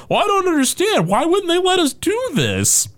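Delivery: high mock-baffled voice; puzzled censors voice. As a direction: play dumb, confused translator. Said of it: "they were like".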